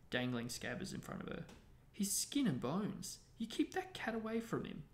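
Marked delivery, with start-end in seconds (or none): 1.9-4.8 high, indignant scolding; furious. A narrator's reading. Ron orders Hermione to control her cat, furiously. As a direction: scold her in a raised pitch. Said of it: "he said furiously at Hermione".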